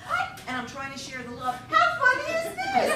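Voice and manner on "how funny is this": high, loud, fast laughing question; laughing squeal. Real, not acted.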